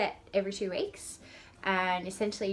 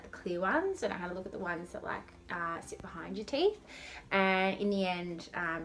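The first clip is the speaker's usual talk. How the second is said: ordinary talk, nothing marked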